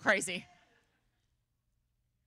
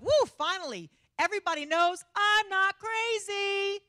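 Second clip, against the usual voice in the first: high, loud victory cry; triumphant gloating; 10 semitones higher, 6 dB louder than usual. Acted. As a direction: crow it out, stretched and loud. Noah gloats on boarding the ark.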